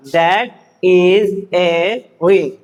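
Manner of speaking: very slow, word by word; drawn-out words, evenly spaced; slow reading drill. Shown as a demonstration. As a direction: model the sentence for beginners, slowly. Teacher models sentence to learners.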